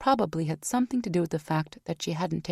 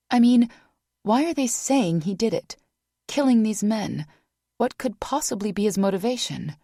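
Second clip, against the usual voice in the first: louder, higher, pressing questions; agitated protest; 6 semitones higher, 4 dB louder than usual. A narrator's reading. Vanessa defending Rock to the team, agitated. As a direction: push back hard.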